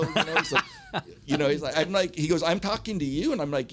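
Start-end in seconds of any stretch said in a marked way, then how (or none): 2.4-3.3 imitated disbelief, high rising question; high-pitched, questioning echo of what he heard; incredulous. Venue owner to band's front man, incredulous.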